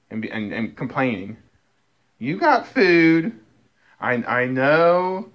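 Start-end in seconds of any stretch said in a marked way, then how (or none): 2.2-5.3 very slow, drawn-out comforting words; patient slow pet talk; slow reassurance to cat. Real, not acted. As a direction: spell it out slowly to a sulky pet. Soothing the sulking cat.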